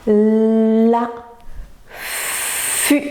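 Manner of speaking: LA with a long held L; FU with the F stretched out as a long hiss; drawn-out sounds. Shown as a demonstration. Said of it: "reconnaître les sons".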